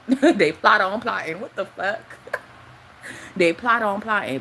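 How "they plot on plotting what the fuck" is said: words squeezed out through real laughter; laughing. Real, not acted. Ranting vlogger laughs at her own line.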